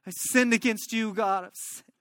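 high, anguished plea fading away; acted tearful cry for forgiveness; weeping confession. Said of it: "He's weeping".

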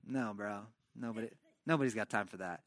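low, soft, unimpressed reply; acted low-key dismissal; deadpan brush-off. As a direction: shut down the overeager greeting quietly. Nathan deflates David's showy greeting.

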